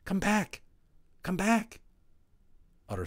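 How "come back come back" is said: higher, louder frantic shout; frantic call. A narrator's reading. Omri calls frantically into the hole, high and loud. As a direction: call desperately into the dark. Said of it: "Omri called frantically".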